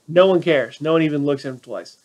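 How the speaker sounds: normal talking voice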